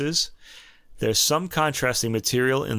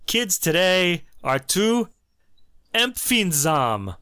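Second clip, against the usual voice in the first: slow, higher-pitched mock pronouncement; mock showing off; 7 semitones higher, slower than usual. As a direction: draw out "empfindsam" to impress. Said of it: "impress your French, you could say".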